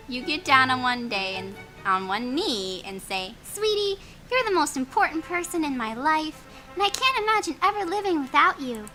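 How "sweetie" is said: usual speaking voice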